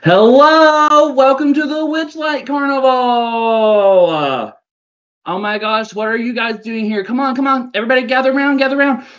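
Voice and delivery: carnival host's welcome. Acted. Carnival host calling newcomers to gather around, high theatrical welcome. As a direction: sing out the welcome like an excitable ringmaster.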